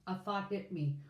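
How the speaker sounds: ordinary delivery, nothing marked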